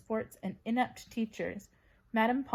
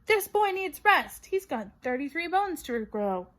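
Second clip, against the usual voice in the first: shouted; 7 semitones higher, 7 dB louder than usual. A narrator's reading. Madame Pomfrey throwing the team out, loud shout. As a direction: bellow them out of the ward.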